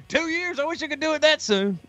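mock an envious Southern clerk; Southern accent. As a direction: counter guy whining in an exaggerated Southern accent. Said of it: "I give everybody a Southern accent".

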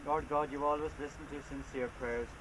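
ordinary delivery

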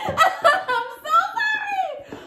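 high, drawn-out apology through laughter; laughing apology. Real, not acted. Reviewer tells viewers this is staying here, laughing apology. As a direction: squeal sorry while cracking up.

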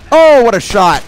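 high, loud cheer; real excited shout; thrilled exclamation. Real, not acted. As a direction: cry out in delight at a great shot. Celebrates a great shot in game.

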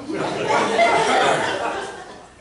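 high, unvoiced burst of laughter; real wheezing laugh; breathless laugh. Real, not acted. Pastor laughing at himself before the congregation, breathy.

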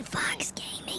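soft whispered ASMR greeting; ASMR whisper for a viewer. Pretend. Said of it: "A S M R".

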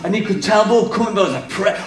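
usual speaking voice

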